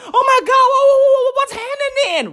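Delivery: high, frantic mock panic, stuttering wha-, wha-. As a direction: imitate a panicking teen, voice jumping up high.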